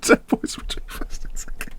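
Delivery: soft breathy whisper of the fixed line; hushed self-correction. Real, not acted. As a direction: quietly correct yourself.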